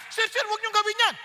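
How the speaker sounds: high, urgent appeal to a king; acted priests pleading with him to stop; warning priests. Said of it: "Sinabihan siya ngayon ng mga saserdote".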